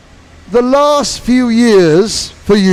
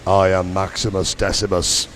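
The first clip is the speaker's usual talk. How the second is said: very deep booming movie-hero voice; low, solemn line for him to repeat; Gladiator impression; 8 dB quieter than usual. Shown as a demonstration. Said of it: "after me".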